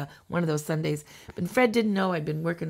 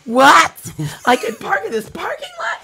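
much higher, laughing amazement; delighted surprise; 10 semitones higher, 11 dB louder, slower than usual. Retold or imitated. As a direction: squeal with happy disbelief.

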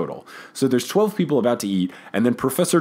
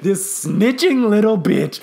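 slow, higher-pitched playful outrage; mock indignant; 7 semitones higher, 4 dB louder, slower than usual. Boys reacting to their friend telling the teacher, mock indignant. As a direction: draw out the insult in mock fury. Voices boys' outrage at being told on.

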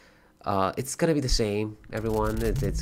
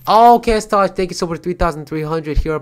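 much higher and louder, bursting with delight; excited thanks; 9 semitones higher, 14 dB louder than usual. Real, not acted. Streamer thanking a donor for bits, excited. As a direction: shout out the donor gleefully.

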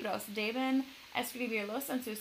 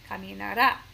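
caminará with the last syllable -RÁ punched loud; acento final incorrecto; 7 dB louder than usual. Shown as a demonstration. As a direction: read caminará like a future tense, stress on the final á. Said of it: "como si fueran levantará". (caminará con énfasis fuerte en la última sílaba)

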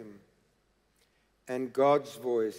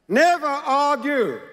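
much higher and louder, a preacher's cry; forceful warning; 11 semitones higher, 8 dB louder than usual. Real, not acted. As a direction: slam the warning down on the congregation. Preacher hammering home a warning.

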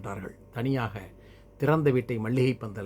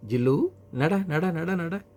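high, repeated pet command; urging a dog; 6 semitones higher, much slower than usual. Acted. Boy making his dog walk, high urging command. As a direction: urge the dog along in a raised pitch.

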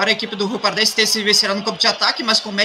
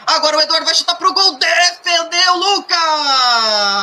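excited save call; 6 semitones higher, 3 dB louder, slower than usual. Real, not acted. Shot and save called live.

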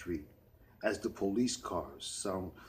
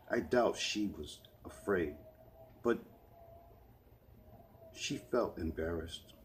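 usual speaking voice

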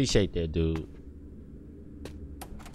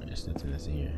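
whisper; 13 semitones lower, 12 dB quieter, much faster than usual. Real, not acted. Creeping through scary game alone.

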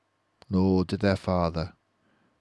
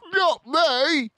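high, louder panicky scream; 6 dB louder than usual. Acted. Bunce screaming as he runs away. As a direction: squeal it as you run. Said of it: "screamed Bunce".

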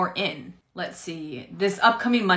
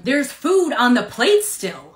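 slow, loud insistence; pointing out the food, slow and raised; exasperated; 5 semitones higher, 5 dB louder, slower than usual. Acted. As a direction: spell it out to the clerk, louder. Retail skit, customer arguing.

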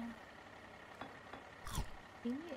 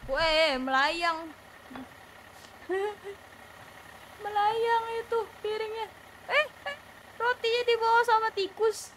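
high, loud amazed shrieking; excited shriek; 11 semitones higher, 8 dB louder than usual. Real, not acted. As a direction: squeal in disbelief. Flying plate, rat steals bread.

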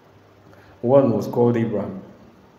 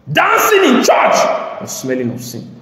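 high, loud, slow denunciation; preaching shout; 13 semitones higher, 7 dB louder, slower than usual. Real, not acted. Preacher shouting at the congregation about sin. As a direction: thunder it from the pulpit.

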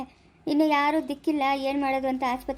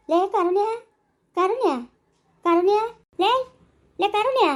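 anxious loud calls, louder and higher; calling out; 5 semitones higher, 4 dB louder than usual. Acted. Calling out to the fallen girl to wake her, anxious shouts. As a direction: shout her name again and again, getting no answer.

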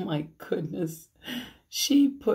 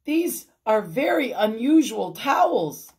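loud, raised-voice remark; higher, projected comment; calling out; 5 semitones higher, 6 dB louder than usual. A narrator's reading. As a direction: call it out from another room. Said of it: "he called".

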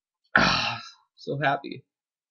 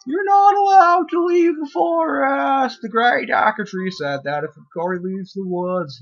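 bossy NPC voice; 11 semitones higher, 9 dB louder than usual. Acted. Reading the NPC's line aloud.

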